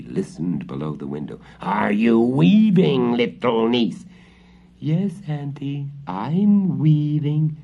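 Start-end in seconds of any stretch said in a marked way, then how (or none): 1.6-4.0 slow, loud witch call through the window. A narrator's reading. Witch checking on her niece outside the window, slow loud call. 4.8-7.6 high, slow squeaky little-girl imitation; the cat faking the girl's voice; squeaky girl mimicry. A narrator's reading. Cat pretending to be the girl answering the witch, high squeaky. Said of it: "in a squeaky voice, trying to mimic Marusha".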